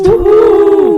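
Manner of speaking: loud high whoop; cheering. Real, not acted. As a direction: whoop like a hype man. Cheering the episode intro.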